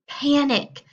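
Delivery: loud, punchy cry of alarm; dramatic exclamation. A narrator's reading. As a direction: call it out like an alarm bell. Narrator exclaims panic.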